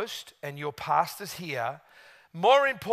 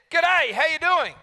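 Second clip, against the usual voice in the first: bright mock Australian "G'day", higher and louder; lightly imitated Australian seatmate; Australian greeting; 10 semitones higher, 7 dB louder than usual. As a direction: toss off a chirpy Aussie hello.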